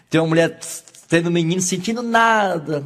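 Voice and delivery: ordinary delivery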